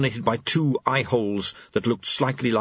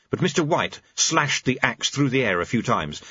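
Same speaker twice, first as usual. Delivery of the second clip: usual speaking voice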